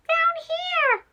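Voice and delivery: very high squeaky little mouse; tiny squeaky voice. Acted.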